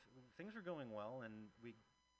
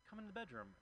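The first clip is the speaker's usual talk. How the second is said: higher pleading imitation of her; mock coaxing woman; imitating the date; 8 semitones higher than usual. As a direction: coax him in a raised voice. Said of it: "She's like".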